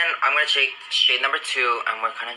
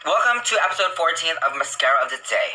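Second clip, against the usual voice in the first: brisk, bright host announcement; fast upbeat intro; show host intro; 5 semitones higher, faster than usual. Real, not acted. Hosting her segment intro.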